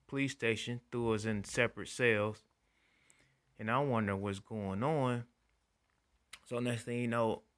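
usual speaking voice

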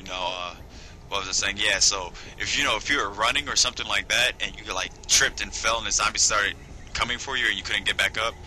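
usual speaking voice

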